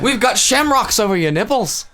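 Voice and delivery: put-on high, lilting joke Scottish accent; mock Celtic announcer. Promo for the naked channel, mock Scottish. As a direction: pitch it up in a hammy Scottish brogue. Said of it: "Playboy Channel for fucking Scottish dudes".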